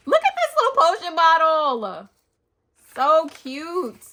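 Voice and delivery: high, loud delighted gush; excited. Real, not acted. Showing viewers a tiny potion bottle, loud delighted gush. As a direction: coo over the tiny bottle.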